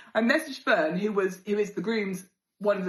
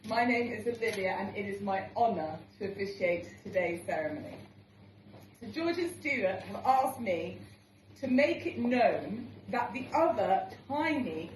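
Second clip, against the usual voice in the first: officiant speech; 4 semitones higher than usual. Acted. Officiant welcoming wedding guests, formal and playful. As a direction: address the guests as the celebrant. Played clip of ceremony speech.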